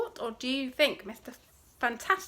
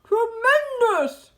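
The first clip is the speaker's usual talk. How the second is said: loud, high, drawn-out exclamation; delighted shout; 10 semitones higher, 11 dB louder, slower than usual. A narrator's reading. Badger hailing the cider find, a big shout. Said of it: "shouted Badger".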